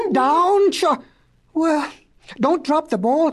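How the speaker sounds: flustered professor; flustered calling. Acted. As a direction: call up to the dog in mild alarm. Professor calls to floating dog.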